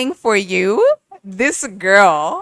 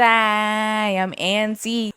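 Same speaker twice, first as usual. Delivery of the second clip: drawn out; much slower than usual. Retold or imitated. Quoting song hook slowly.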